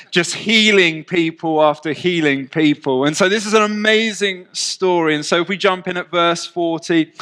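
usual speaking voice

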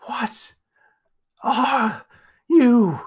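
frightened, rising high question; trembling voice. Acted. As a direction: ask it half terrified. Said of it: "his voice trembling".

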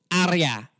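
put-on "Arya" with a Filipino accent; light Filipino accent on "Arya". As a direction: say "Arya" as a Filipino might, pure vowels. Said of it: "maybe your Filipino would go".

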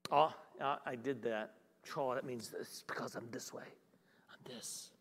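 low, hushed talking to oneself; self-condemning murmur. Acted. Preacher acting out how we judge ourselves, hushed self-blame. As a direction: voice a private self-judgment, very soft and sinking.

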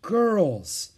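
weary drawn-out call; tired. A narrator's reading. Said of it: "said Mother in a tired voice".

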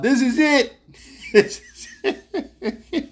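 high, loud yell breaking into laughter; retold yell. Sick man on the cruise yelling in despair, high laughing yell. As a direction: yell it out, then laugh it away. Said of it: "I just remember yelling out".

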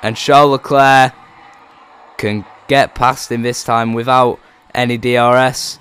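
loud, high race call; excited commentary. Acted. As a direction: call the lock up with urgency.

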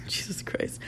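embarrassed whisper under the breath. Real, not acted. Recalling the embarrassing dance video, whispering. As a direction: breathe it out in mortified disbelief.

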